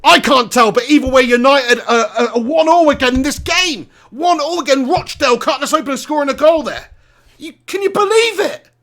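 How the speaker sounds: high, loud indignant outburst; exasperated disbelief. Real, not acted. Fan commentator lamenting the equaliser, loud exasperated disbelief. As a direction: cry out the score in outraged disbelief.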